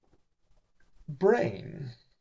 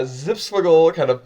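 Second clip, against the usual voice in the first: ordinary delivery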